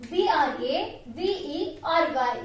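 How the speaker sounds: slow spelling. Shown as a demonstration. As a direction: spell B R A V E R Y letter by letter. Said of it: "How to pronounce this word?".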